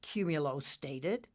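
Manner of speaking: usual speaking voice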